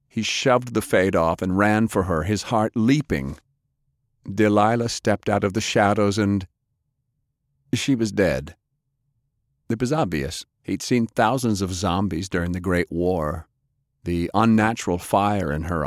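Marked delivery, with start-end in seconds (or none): none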